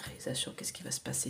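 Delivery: fast unvoiced whisper, asking the cards; quick whisper. Real, not acted. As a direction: rush the question out under your breath. (chuchotement rapide adressé aux cartes)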